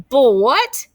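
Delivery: loud, high, baffled question; a kid who can't believe the word; puzzled question. Acted. Classmate asking about lunch, baffled and loud.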